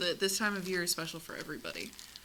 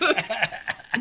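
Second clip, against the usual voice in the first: laughing; 3 semitones higher, 8 dB louder than usual. Real, not acted. Teasing laugh after denial.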